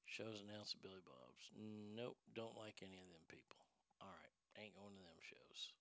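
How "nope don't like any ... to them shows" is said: fast whispered grumble; whispering. Real, not acted. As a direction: grumble it under your breath. Muttered aside.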